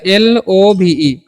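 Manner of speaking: slow, letter by letter; spelling out letters. Shown as a demonstration. Teacher spells out love for students, slowly. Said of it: "लो भी नहीं पढ़िएगा".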